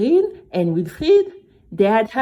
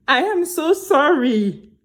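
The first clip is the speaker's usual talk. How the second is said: tearful, slow and higher apology; crying apology; 6 semitones higher than usual. Acted. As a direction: sob out the apology. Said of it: "Mabel started crying".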